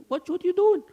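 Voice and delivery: high, loud mock outrage at the daughter; mock shocked mother. Mother shocked at her daughter, high mock outrage. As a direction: squeal it like a scandalized mother. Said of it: "she goes to her daughter".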